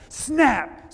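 loud, high burst on one word; exclaimed snap. Retold or imitated. Preacher acts out a golf club breaking, loud exclamation.

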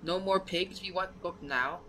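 high-pitched, demanding goblin voice; little goblin. Acted. Goblins demand the book from party, high goblin voice. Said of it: "They say".

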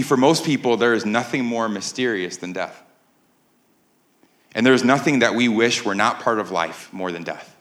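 usual speaking voice

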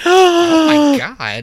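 high, slow, loud shocked "oh my God"; shocked gasp. Real, not acted.

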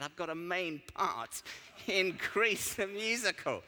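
hammed-up dismay; mock horror. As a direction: deliver the casting like a disaster. Friend reveals dreaded casting.

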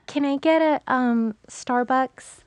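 put-on high, drawn-out Valley girl American accent. Shown as a demonstration. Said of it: "I think I just go Valley automatically".

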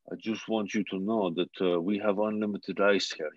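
imitated Russian-accented coach. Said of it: "like, a Russian accent".

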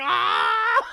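mock anguished yell; anguished wail. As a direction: let out a half-laughing wail of despair. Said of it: "I just wanted to be like".

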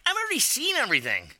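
high, quick mocking impression of her; mock girly voice; high mock friend voice. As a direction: squeak it out fast like the friend. Friend voiced in retold scene.

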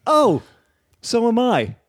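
high, chirpy mock-excited woman; a bright squeaky impression of the fan; imitating her surprise. Fan at the concert telling [name] she's a stand-up too, in a high mock-chirpy voice. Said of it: "And she said".

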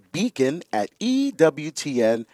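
ordinary delivery

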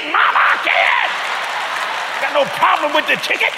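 crank it up like the crowd is with you; preaching shout. Real, not acted.

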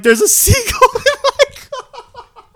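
very high, slow, laughing wail; incredulous wail. Retold or imitated. Driver explaining the seagull to the man behind, incredulous wail. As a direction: re-enact helpless disbelief, breaking into laughter.

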